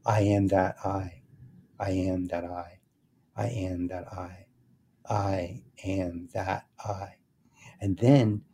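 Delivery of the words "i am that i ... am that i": slow, hushed chant, fading softer; mantra dropping a word. Shown as a demonstration. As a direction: repeat it slowly, sinking quieter each time. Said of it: "start dropping the last word".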